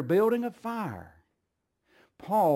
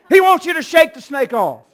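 even higher, ringing preacher's shout; louder shouted repeat; 12 semitones higher, 14 dB louder than usual. Acted. Preacher driving the point home to the congregation, higher shout. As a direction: repeat it and push it further.

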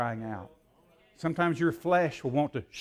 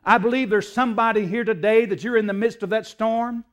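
loud, raised preacher's delivery; forceful preaching; 7 semitones higher, 9 dB louder than usual. Acted. Preacher urging somebody in the midst of a storm, forceful preaching. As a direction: preach it hard and urgently.

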